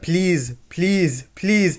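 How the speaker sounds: imitated deadpan plea, each word separate; mock begging in a subdued monotone; monotone pleading. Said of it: "But it's so monotone".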